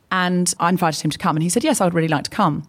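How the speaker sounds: ordinary delivery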